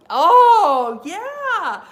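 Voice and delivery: very loud, high burst; excited oh yeah. Real, not acted. Fan remembering the crowd, a loud high oh yeah. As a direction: burst out with the memory. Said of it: "recalling the Canadian crowd".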